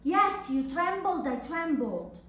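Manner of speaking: ordinary delivery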